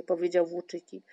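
ordinary delivery, nothing marked

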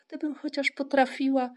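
bashful mother; modest. A narrator's reading. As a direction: say it humbly, doubting your own ability. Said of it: "powiedziała skromnie".

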